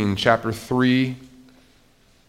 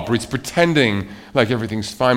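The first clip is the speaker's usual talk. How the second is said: ordinary talk, nothing marked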